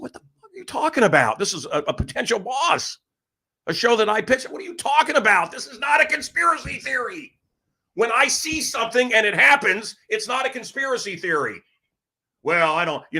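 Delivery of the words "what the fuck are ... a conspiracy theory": high-pitched indignant rant, retold; losing it. Retold blow-up at a potential boss, high outraged rant. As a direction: act out blowing up in outrage. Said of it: "and I lost it".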